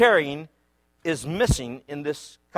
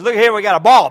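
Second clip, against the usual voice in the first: calling out; 5 semitones higher, 11 dB louder than usual. Retold or imitated.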